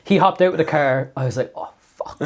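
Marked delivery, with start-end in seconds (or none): 1.5-2.2 hushed, voiceless retold oh-no moment; whispered dread. Retelling the driver getting out of his car, whispered dread. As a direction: whisper it under your breath in mock dread.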